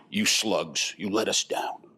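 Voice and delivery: low, contemptuous put-down; mock scolding boss. Management scolding the team, mock low scorn. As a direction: sneer it like a disappointed boss.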